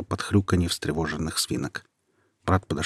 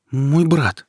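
anxious plea; 8 semitones higher, 5 dB louder than usual. A narrator's reading. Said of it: "сказала она".

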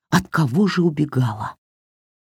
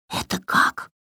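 unvoiced hushed question; whispering; 6 dB quieter than usual. A narrator's reading. Варя шепчет в кустах. (шёпотом)

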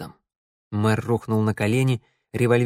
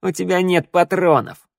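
higher, gloating delivery; snarling taunt; 6 semitones higher, 3 dB louder than usual. A narrator's reading. Shnyryala calls the armed mayor's bluff, sneering. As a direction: call his bluff with relish. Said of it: "оскалилась шныряла".

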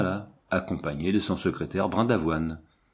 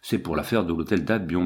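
ordinary delivery, nothing marked

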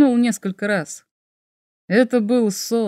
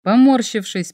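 plain reading voice

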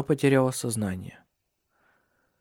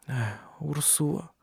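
very soft, slow, weary murmur; whispered; 3 semitones higher, 9 dB quieter, slower than usual. A narrator's reading. Cecilia answering her remorseful sister-in-law, whispering. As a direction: breathe the name out weakly, slowly. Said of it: "шептала Сесилия".

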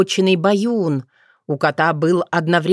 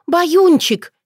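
high, louder cry of a pet name; delighted cry; 12 semitones higher, 4 dB louder than usual. A narrator's reading. Girl rushing to her cat, crying out. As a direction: call out as you rush over. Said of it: "кинулась к нему отрада".

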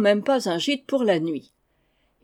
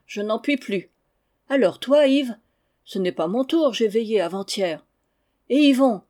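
quarreling farmhands; 3 semitones higher, 4 dB louder than usual. Acted. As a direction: grumble and shove the job to the next man. Valets se disputent à qui veillera. (valets qui se chamaillent, voix plus haute et plus forte)

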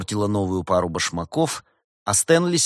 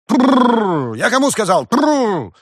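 high, loud rolled trills and scolding; shouted command; 13 semitones higher, 10 dB louder, much slower than usual. A narrator's reading. Farmer ordering his oxen to halt, shouting. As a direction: bellow for the team to stop.

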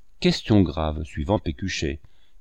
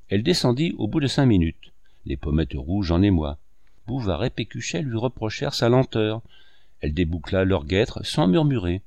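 plain reading voice

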